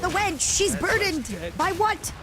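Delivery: mock shock. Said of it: "It's be like".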